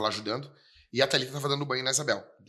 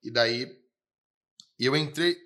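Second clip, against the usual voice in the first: normal talking voice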